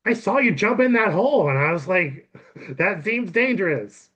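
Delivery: put-on high, emphatic girl character; acted concern, high and lively; concerned rescuer. Girl explains her worried rescue.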